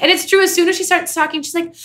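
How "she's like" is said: ordinary talk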